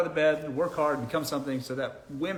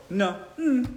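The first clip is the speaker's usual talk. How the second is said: high, curt mock refusal; 8 semitones higher than usual. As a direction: act uninterested, with attitude.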